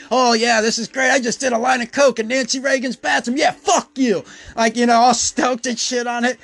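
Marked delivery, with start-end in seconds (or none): 0.1-4.2 higher, bouncy giddy glee; jolly and giddy. Retold or imitated. Retelling himself gloating in the bathroom, giddy. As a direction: gloat like a kid who got away with it. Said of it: "kinda all jolly and giddy".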